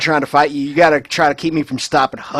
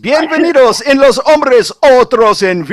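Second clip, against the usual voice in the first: lucha libre announcer; 9 semitones higher, 7 dB louder than usual. Acted. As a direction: announce them like a wrestling MC. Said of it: "Best entrance ever".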